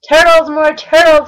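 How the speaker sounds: loud high bellow; king bellows for turtles. Acted. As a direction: bray the call across the pond. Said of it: "he bellowed and brayed".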